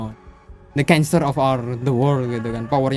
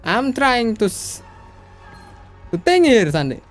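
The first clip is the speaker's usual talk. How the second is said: exasperated; 7 semitones higher than usual. Real, not acted. Streamer scolds game villain's monologue.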